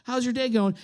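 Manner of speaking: put-on friendliness, dead inside; forced smiley greeting. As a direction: greet them with a pasted-on smile. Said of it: "And I'm smiling, but you can tell".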